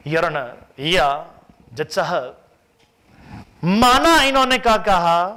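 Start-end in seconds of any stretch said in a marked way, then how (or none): 3.6-5.3 a lawyer pressing the judge, higher and louder; courtroom argument. Acted. CA arguing before the judge. (वकील की तरह ऊँची, तेज़ दलील)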